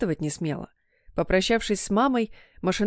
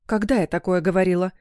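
ordinary delivery

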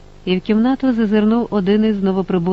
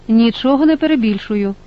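higher, mildly indignant retort; narrated indignation, pitched up; 4 semitones higher, 4 dB louder than usual. A narrator's reading. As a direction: protest at being doubted. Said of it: "обурилася та".

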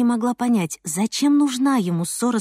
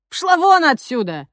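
rude thug voice; 6 semitones higher, 4 dB louder than usual. Acted. Thug chasing girl off.

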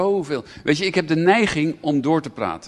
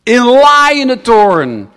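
loud, raised voice, dragging out the wrath; God's voice in burning anger, proclaimed; fiery preaching; 9 semitones higher, 12 dB louder, slower than usual. Acted. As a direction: thunder it from the pulpit. Preaching God's words at full force.

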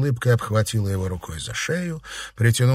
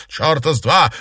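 loud, higher-pitched outburst; blurted refusal; 5 semitones higher, 5 dB louder than usual. A narrator's reading. Girl rejecting a hug from rival, blurted angrily. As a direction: fire it out angrily. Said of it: "выпалила Ирина".

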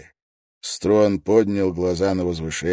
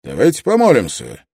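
high, booming call to someone in a crowd; raised, commanding public summons; loud announcement; 9 semitones higher, 11 dB louder than usual. A narrator's reading. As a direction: call out for all to hear. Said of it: "и громко объявил".

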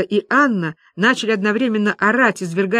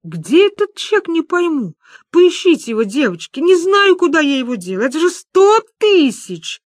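high, loud panicked wail; frantic; 9 semitones higher, 4 dB louder than usual. Acted. Люба begs friends to find the cheque, frantic. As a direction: cry out in mounting panic, stretching key words.

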